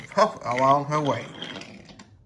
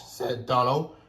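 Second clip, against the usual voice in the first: ordinary delivery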